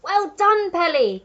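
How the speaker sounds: high, loud proud cheer; Giraffe crying out. A narrator's reading. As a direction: praise him warmly. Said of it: "cried the Giraffe".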